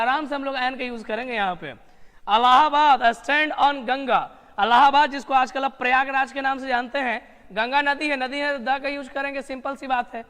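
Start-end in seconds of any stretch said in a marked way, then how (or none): 1.7-4.4 slow and louder, word by word; slow dictation read. Shown as a demonstration. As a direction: read it slowly to the class. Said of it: "dictation read". (धीरे और ज़ोर से वाक्य पढ़ना)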